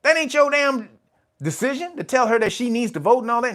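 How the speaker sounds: rip into them like an angry mother; outraged yell. Retold or imitated. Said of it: "I'm like".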